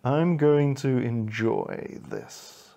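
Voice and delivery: slow, low, relishing threat; gloating villain fox. Acted. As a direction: savour the moment, drawn out low. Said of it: "Steve smiled and said".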